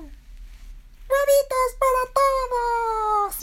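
very high, drawn-out chick yell; squeaky shout. Acted. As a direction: call out to every child in a loud squeak. Chick calls out to all children.

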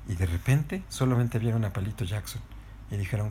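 plain reading voice

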